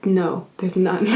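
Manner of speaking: usual speaking voice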